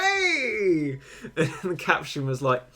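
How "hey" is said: imitated crowd cheer. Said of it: "And everyone's like".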